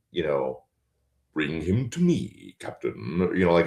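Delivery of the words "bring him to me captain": Darth Vader. Shown as a demonstration. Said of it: "I end up doing".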